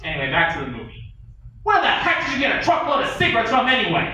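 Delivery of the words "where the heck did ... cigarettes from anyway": higher, louder demanding yell; yelling boss. Acted. The captain demanding answers, a loud high yell. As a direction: bark the question at him.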